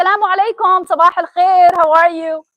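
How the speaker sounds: loud, bright Syrian-style hello to the whole room; loud cheerful greeting. Retold or imitated. Student greeting a silent classroom in Syria, loud and friendly. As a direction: call the greeting out warmly, loud. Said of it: "In Syria, you have to say".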